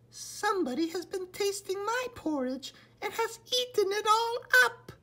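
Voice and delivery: put-on a tiny baby bear crying out, high and fast; little wee bear voice. A narrator's reading. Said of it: "cried the little wee bear in his little wee voice".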